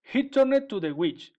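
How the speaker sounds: plain reading voice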